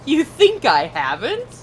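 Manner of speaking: slow, laughing incredulous reply; laughing retort. Retold or imitated. Answering the coworkers, laughing in disbelief. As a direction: laugh it back at them. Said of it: "I'm like".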